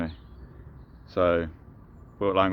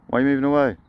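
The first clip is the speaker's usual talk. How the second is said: higher and louder, mock offended; mock hurt; 7 semitones higher, 5 dB louder, faster than usual. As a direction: call after them as if snubbed. Ducks walk away from him.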